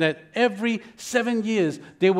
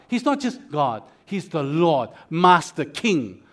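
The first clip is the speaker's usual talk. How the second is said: ordinary talk, nothing marked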